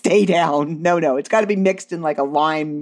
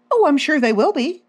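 innocent fib; 9 semitones higher than usual. Retold or imitated. Teen lying to mom innocently.

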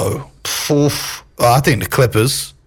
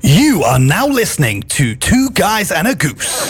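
slow, high-pitched station announcer; radio voice; 6 semitones higher, slower than usual. Shown as a demonstration. Guest repeats the host's show line on request, radio voice. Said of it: "use that same voice and say".

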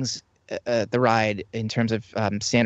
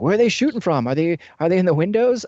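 higher, louder mock panic of a gangster in a shootout; panicked gangster Muggsy; 9 semitones higher, 5 dB louder than usual. Guide playing Muggsy with no gangster car, higher panicked mock gangster. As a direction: play the lost gangster looking for his shooters. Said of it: "coming out as Muggsy".

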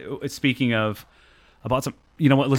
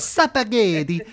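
mock Italian accent; 8 semitones higher, much slower than usual. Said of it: "trying to come up with an Italian accent".

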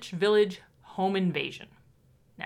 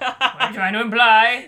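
mock offended; 11 dB louder than usual.

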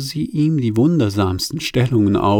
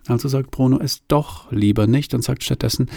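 ordinary delivery

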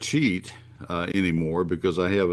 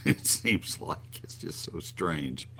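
laughing; 7 dB quieter, slower than usual. Real, not acted. Laughs at odd weather.

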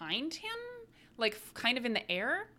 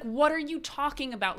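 raised, higher exasperated husband; husband snapping at his wife in an argument, exasperated; 6 semitones higher, 4 dB louder than usual. Retold or imitated. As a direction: push back at her, disbelieving.

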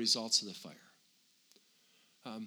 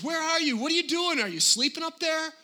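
much higher, louder frustrated yelling at heaven; King David going off on God; 9 dB louder than usual. Retold or imitated. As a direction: rail at God like an exasperated king. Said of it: "He goes off and says".

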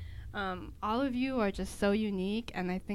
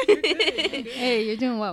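laughing warmly, cheering her on; loud, laughing encouragement; 4 semitones higher, 13 dB louder, much slower than usual. Real, not acted. Encouraging a nervous speaker.

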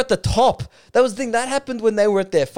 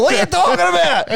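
mock shout; 6 semitones higher than usual. Footballer snapping back at the interviewer, quick mock shout. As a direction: fire off "What are you talking about?" in one outraged burst. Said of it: "It's like".

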